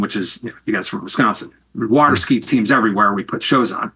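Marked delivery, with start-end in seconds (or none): none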